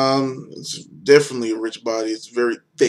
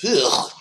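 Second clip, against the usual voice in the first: disgusted groan; 4 semitones higher, 5 dB louder than usual. Real, not acted. Disgusted by beer taste.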